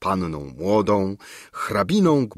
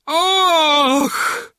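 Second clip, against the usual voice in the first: much higher, louder rattling cry; horrified gasp before fainting; 5 dB louder than usual. A narrator's reading. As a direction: gasp and collapse. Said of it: "zacharczała okropnie". (okropne charczące „Och!” przed omdleniem)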